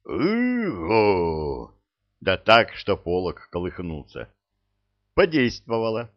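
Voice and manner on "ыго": bellowed hoot, drawn out; roaring hoot. Acted. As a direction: roar it out like a horn. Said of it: "так как взревёт".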